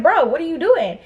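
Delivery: high-pitched, fed-up question; retold exasperated appeal. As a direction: challenge a friend in disbelief. Confronting her friend over the keys.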